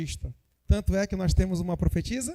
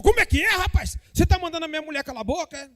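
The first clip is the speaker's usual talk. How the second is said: indignant outburst; 6 semitones higher, faster than usual. Pretend. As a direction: snap back at the man who insulted his wife. Acted pastor furious at insult. (indignação alta e rápida)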